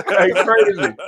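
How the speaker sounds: high, laughing through words. Real, not acted. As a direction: squeal it out laughing. Laughing about teddy bears.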